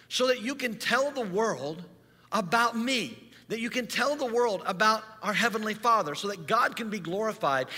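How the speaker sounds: high, loud impassioned sermon; rally the congregation with conviction; ringing pulpit voice; preaching at full force. Acted.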